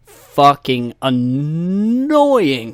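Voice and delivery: drawn-out annoyance, loud; exasperated emphasis. Real, not acted. Podcaster griping about fake call-ins, exasperated. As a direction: stretch the word out in irritation.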